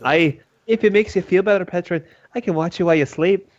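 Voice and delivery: put-on lightly accented character offering watch; in-character adventurer. Offering Petron a watch over sleep, in character.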